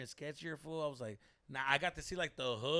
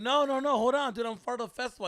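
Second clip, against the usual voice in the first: higher, louder rush to explain; frantic pleading; 7 semitones higher, 11 dB louder than usual. Retold or imitated. Lost comedian convincing another comedian he belongs, higher louder plea. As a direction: reassure him quickly, voice up and loud.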